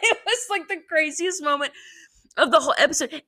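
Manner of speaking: high squealing laugh through words. Real, not acted. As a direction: laugh in disbelief. Laughing in disbelief.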